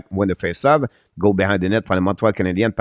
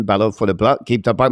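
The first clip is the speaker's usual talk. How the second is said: usual speaking voice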